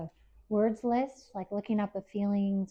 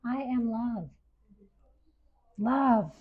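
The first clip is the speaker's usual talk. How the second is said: ordinary talk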